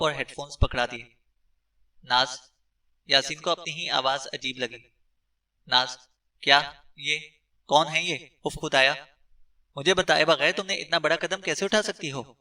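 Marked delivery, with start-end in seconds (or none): none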